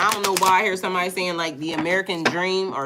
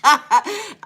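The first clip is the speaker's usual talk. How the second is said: high, loud burst of laughter; cracking up; 10 semitones higher, 7 dB louder than usual. Real, not acted. Streamer cracks up after rapping, high loud laughter. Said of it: "cracking up".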